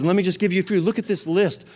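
usual speaking voice

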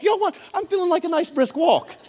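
high-pitched, wheedling excuse; comic excuse-making. Acted. Disciples dodging the boat trip, high comic excuse. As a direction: pitch it up, dodging with false keenness.

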